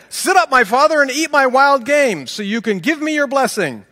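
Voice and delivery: high, loud, eager call to a father; eager son. Acted. Esau bringing his father the meal, eager and loud. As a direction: pitch it up high and proud, urging him on.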